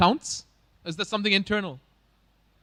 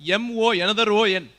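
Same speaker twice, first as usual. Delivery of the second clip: louder, imitated Malayali spelling-out; Malayali accent; 6 dB louder than usual. Host spelling moon as a Malayali, playful Malayali accent. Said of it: "spelling moon as a Malayali".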